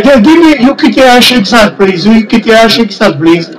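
ordinary delivery

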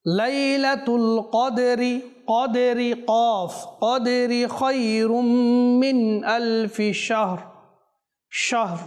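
slow melodic chant with drawn-out vowels; slow Quran recitation. Shown as a demonstration. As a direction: recite the verse slowly in tajweed. (ধীর সুরেলা তিলাওয়াত)

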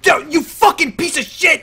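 high-pitched cursing yell; angry outburst. Real, not acted. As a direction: curse at the game, voice jumping high. Cursing at the game.

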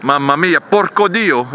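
put-on exclaiming in Italian accent; Italian exclamation. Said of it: "As the Italians would say".

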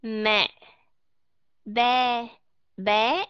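mẹ, be, bé with the open Vietnamese e; teacher drilling Vietnamese e words; Vietnamese e model. Shown as a demonstration. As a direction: model mẹ, be, bé one by one. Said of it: "âm E ở trong Việt Nam đọc là E".

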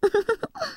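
soft, short giggle; little giggle. Acted. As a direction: giggle quietly to yourself. 独白中の照れ笑い. (小さくクスクス笑う)

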